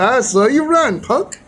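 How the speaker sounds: high, smirking retold taunt; smug taunt. Crazy guy taunting the speaker who ran, smirking. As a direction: tease him for running, with a smirk. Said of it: "just looked at me and smiled and said".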